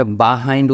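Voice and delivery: "behind" as "buh-hind"; an open first vowel in "behind"; corrected pronunciation. Shown as a demonstration. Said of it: "উচ্চারণটা হবে". ("behind"-কে "বাহাইন্ড" উচ্চারণ)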